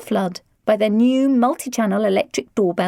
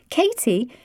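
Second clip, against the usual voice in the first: ordinary delivery, nothing marked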